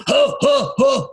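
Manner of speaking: short, high, breathy mock chuckles; a guy's mock premature chuckle, lightly done; fake laugh. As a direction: imitate a man laughing too early at a joke. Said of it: "one guy laughed at every premise".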